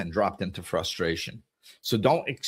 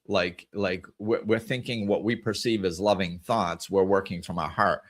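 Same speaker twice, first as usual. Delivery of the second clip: ordinary delivery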